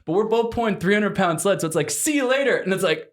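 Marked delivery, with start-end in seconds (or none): 1.9-2.6 high, chirpy so-long; mock breezy goodbye. Bidding Captain Lou goodbye, high and chirpy. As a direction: sing it out like a cheery farewell.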